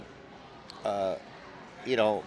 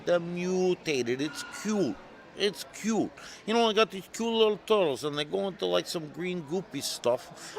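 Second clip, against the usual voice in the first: Jersey pitchman; 9 semitones higher, 5 dB louder than usual. Retold or imitated. Jersey caller correcting the illustrator about his turtles, mock insistent. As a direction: sell it in a hammed-up Jersey voice, stretching key words. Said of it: "some guy from Jersey".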